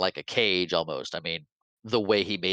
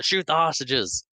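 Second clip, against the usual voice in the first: mock yell; 7 semitones higher than usual. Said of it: "Bison yells".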